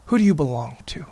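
fast, hushed whisper of the question. Acted. Preacher repeating the father's question to the church, in a hushed whisper. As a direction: breathe the question like a secret.

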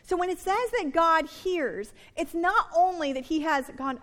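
normal talking voice

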